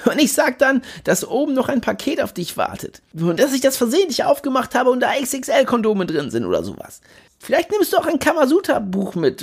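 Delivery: cocky friend, pushy and carefree; Jimmy Blue voice. Acted. Jimmy spinning the plan on.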